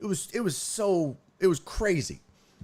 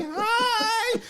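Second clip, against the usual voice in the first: high, cheery hooted greeting; oblivious aunt. Retold or imitated. Aunt greets worker asking her to move, oblivious. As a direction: imitate her trilling back cluelessly. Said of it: "She's oblivious".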